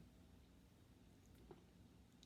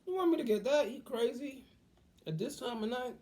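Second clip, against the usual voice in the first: squeaky, much higher incredulous what; high-pitched disbelief; 6 dB louder than usual. Retold or imitated. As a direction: act floored by a crazy midnight request. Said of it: "I didn't look at her like".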